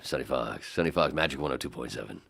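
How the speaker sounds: low DJ station ident; Sonny Fox. Retold or imitated. Imitating the host's station ident, DJ impression. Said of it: "That was Sonny Fox all the time".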